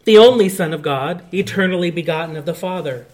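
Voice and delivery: solemn, measured recital of the creed, loud at first; recited like a congregation's creed; creed recitation. Acted. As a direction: proclaim each phrase of the creed.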